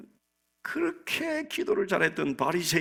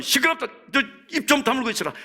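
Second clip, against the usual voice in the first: high-pitched acted rebuke; bystander's raised, hushing scold; 8 semitones higher than usual. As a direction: shut someone up sharply, pitch jumping up. Acting a bystander silencing the beggar.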